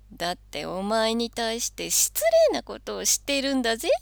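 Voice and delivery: loud high pushy devil. Acted. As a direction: needle him, loud and cocky. Devil pressing Santa.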